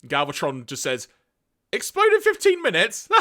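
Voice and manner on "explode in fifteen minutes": high scornful laugh through the words; mocking laugh. Galvatron scoffing at Zarak's warning, high mocking laugh.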